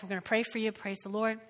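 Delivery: ordinary talk, nothing marked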